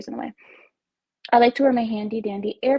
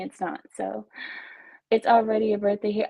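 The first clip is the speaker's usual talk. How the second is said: ordinary delivery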